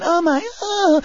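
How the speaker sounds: high, breathless swooning "oh my"; high-pitched giggly swooning; giggling admirer. Retold or imitated. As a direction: swoon like a starstruck fan. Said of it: "beautiful women were walking up going".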